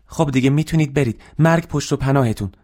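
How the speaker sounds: quick, high-pitched send-off; hurried dismissal. Acted. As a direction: rush the customer out. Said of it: "با عجله با مشتری دست داد و از شرش خلاص".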